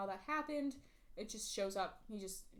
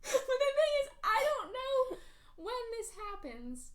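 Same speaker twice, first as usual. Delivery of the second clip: exasperated; 12 semitones higher, 6 dB louder than usual. Real, not acted. Exasperated high-pitched complaint.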